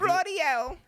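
high, loud mock-British exclamation; British accent. Host outdoes co-host's British, high loud caricature British. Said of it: "my British accent was better".

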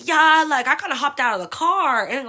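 loud, high, stretched-out reply; sheepish admission, acted. Lost girl admitting to her friend she left the car, acted blurting. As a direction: blurt out the confession, drawing it out. Retelling distressed call to friend.

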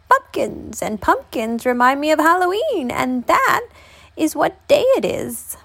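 put-on old woman. A narrator's reading. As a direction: play the old woman up high. Old woman answers the old man.

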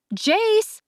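high sharp call up the stairs; shouting a name. A narrator's reading. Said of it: "I shouted".

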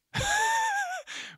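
a sudden squeaky burst of laughter; high-pitched laugh. Real, not acted. Laughing at himself after the first belch on the podcast. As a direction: cackle at your own burp.

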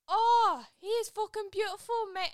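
big loud high "oh" in mock British; exaggerated UK accent, loud and swooning. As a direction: gush like a Manchester lad, hammed up. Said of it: "it's just so U K".

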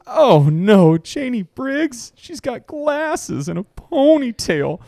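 high, drawn-out hammed-up dismay; mock horrified jock. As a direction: wail the flaws in exaggerated disgust. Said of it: "And he goes".

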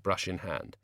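ordinary delivery

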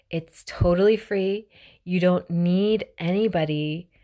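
ordinary talk, nothing marked

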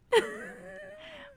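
a squealing real laugh; high burst of laughter. Real, not acted. Laughing at her own accidental Minnesotan accent.

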